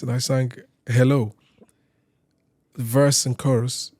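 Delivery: usual speaking voice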